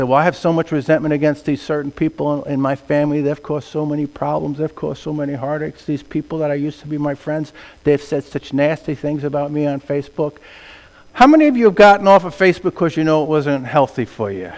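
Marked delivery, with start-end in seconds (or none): 0.0-10.4 softer, low grumbling complaint; a quietly aggrieved listener venting; mock resentful churchgoer. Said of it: "You say".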